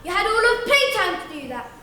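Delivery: loud, high scolding refusal; strict teacher. Acted. Teacher refusing the whiny kid, loud stern scolding. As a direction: shout the pupil down sternly. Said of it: "And she'd say".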